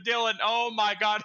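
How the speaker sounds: telling teammate Dylan about the big mistake; exasperated. Retold or imitated. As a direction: call out to a friend in stunned frustration. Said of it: "I said".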